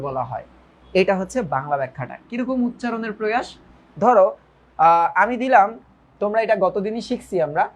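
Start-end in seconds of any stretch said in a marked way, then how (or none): none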